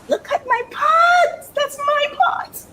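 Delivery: very high, stretched squeal of pride; overjoyed. Real, not acted. Vlogger showing off her very own pot, squealing. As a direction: squeal slowly, thrilled to own it.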